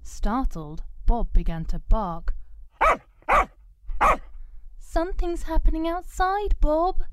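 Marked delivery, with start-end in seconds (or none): 4.8-7.0 uneasy. Acted. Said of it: "feeling uneasy".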